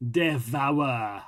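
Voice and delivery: ordinary talk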